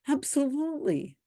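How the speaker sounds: high, sarcastic "Absolutely"; a mock-enthusiastic "Absolutely"; sarcastic agreement. Real, not acted. Mocking agreement with a comment.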